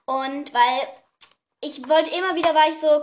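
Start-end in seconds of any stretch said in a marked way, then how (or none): none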